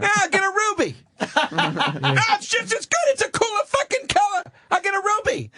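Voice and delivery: high-pitched, laughing through the words; high giggly voice. Acted. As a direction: squeal it out, giggling. Giddy joke about rubies.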